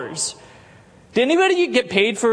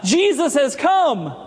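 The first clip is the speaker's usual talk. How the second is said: mock crowd shouting hosanna; retold jubilant shout, higher; crowd's joyful cry; 7 semitones higher than usual. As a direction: cry it out like the Palm Sunday crowd. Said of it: "they said".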